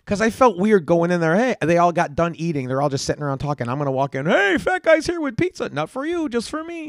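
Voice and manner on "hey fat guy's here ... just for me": very high, singsong put-on voice; hammed-up high-pitched greeting, softening toward the end; mock grand entrance. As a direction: announce yourself in a silly high voice. Imagined announcing his entrance with pizza.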